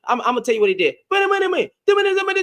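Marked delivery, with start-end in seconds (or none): 1.1-2.4 pitched way up to stop the argument; urgent interrupting. Real, not acted. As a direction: jump in high to cut him off. Cutting in on a shouting opponent.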